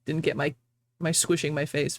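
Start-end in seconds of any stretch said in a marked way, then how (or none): none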